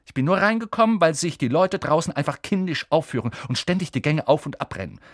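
high, sniffy and superior; haughty. Acted. Hermine leaves the boys' compartment, haughty. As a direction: talk down to them. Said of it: "sagte Hermine hochnäsig".

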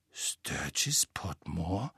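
ungläubig, ganz langsam gehauchtes „Sturgis Potmore?“ mit fragendem Anstieg; flüster den Namen zögernd und fragend; langsam geflüstert. A narrator's reading. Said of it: "sagte Ron langsam". (Ron erkennt den Namen, langsam geflüstert)

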